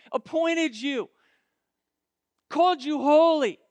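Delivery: punched, rising list from the pulpit; emphatic preaching. Real, not acted. Preacher lists what God has done for you, emphatic preaching. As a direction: build the list phrase by phrase for the congregation.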